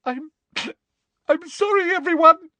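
high, choking tearful apology; hammed-up high wail with catching breath; desperate fake sobbing. A narrator's reading. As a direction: sob it out theatrically. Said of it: "Hermione was sobbing desperately into her hands".

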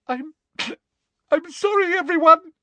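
very high, tearful halting apology; distraught girl choking out words; sobbing. A narrator's reading. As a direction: apologise between faked sobs. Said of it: "Hermione was sobbing desperately".

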